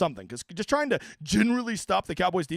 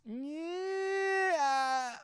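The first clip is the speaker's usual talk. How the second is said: groaning yeah; 10 semitones higher, 7 dB quieter than usual. Real, not acted. Groaning at a chat question.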